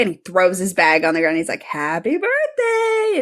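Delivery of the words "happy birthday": high, slow, drawn-out sing-song; sing-song greeting. Retold or imitated. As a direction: stretch "Happy birthday" way up high and slow. Nick greets Charlie, two takes.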